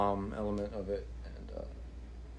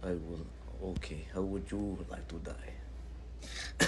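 hushed, slow Russian villain; Russian accent; 3 semitones lower, 7 dB quieter, slower than usual. Pretend. Host mock-threatening co-host over his score, quiet Russian accent. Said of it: "keep doing this accent".

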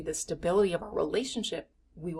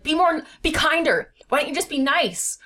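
much louder and higher, harshly demanding; 8 semitones higher, 9 dB louder than usual. Retold or imitated. Partner harshly demanding niceness, loud and aggressive. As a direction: bark a demand for kindness. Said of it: "we're being harsh and aggressive".

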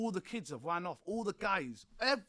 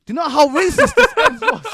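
much higher and louder, incredulous; can't believe it, almost shouting; outraged; 12 semitones higher, 18 dB louder than usual. Real, not acted. Outraged recounting racist crowd.

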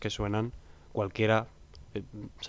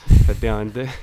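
emphatic loud agreement; 13 dB louder than usual. Real, not acted.

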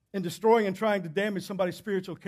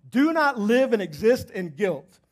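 slow emphatic; 3 dB louder, slower than usual. Real, not acted. Preacher slows for emphasis.

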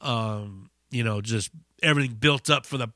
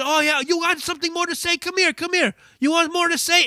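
high, fast mock dare; imitating the singer; 14 semitones higher, faster than usual. Singer baiting the heckler to come closer, high mock dare. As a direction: bait him to come closer.

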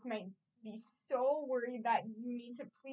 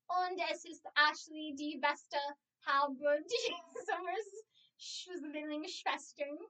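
speaking German; 3 semitones higher, slower than usual. Pretend. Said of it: "Ashley thought that meant speak in German".

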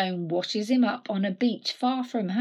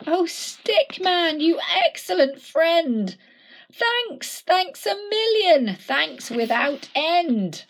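loud, high, joyful thanks; grateful cheer; 8 semitones higher, 5 dB louder, slower than usual. Acted. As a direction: thank your rescuer with all your heart. Santa thanks Stickman.

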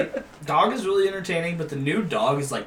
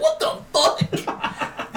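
drawn-out high, laughing disbelief; retold incredulous squeal; stunned disbelief; 13 semitones higher, much slower than usual. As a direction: stretch it out slowly in mock shock. Retelling own stunned reaction.

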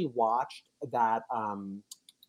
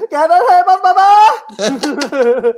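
high excited squeal; shouting; 15 dB louder, much slower than usual. Acted. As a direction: squeal it out loud. Excited callback to cohost.

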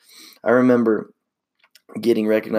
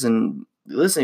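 ordinary talk

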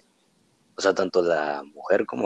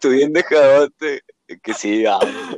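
teasing mock voice; 6 semitones higher, 8 dB louder, slower than usual.

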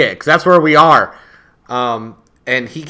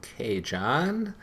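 deadpan; 2 semitones lower, 11 dB quieter, much slower than usual. Retold or imitated. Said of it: "Miz would look at him and go".

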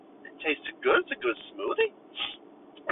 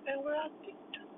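usual speaking voice

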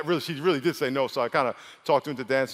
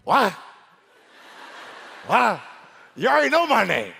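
indignant; 6 semitones higher, 4 dB louder than usual. Acted. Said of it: "He's like".

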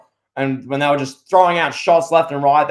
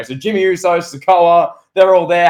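normal talking voice

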